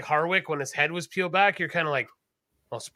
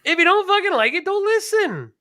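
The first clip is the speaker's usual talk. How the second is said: mock telling off; 13 semitones higher, 4 dB louder than usual.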